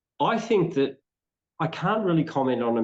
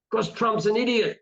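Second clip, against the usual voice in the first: higher-pitched mock bluster; mildly blustering, pitched up; retold bluster; 8 semitones higher than usual. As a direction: imitate someone blurting out an indignant answer. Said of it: "he just blustered".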